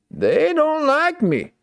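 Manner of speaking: high sing-song whine. Shown as a demonstration. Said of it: "repeated in another voice".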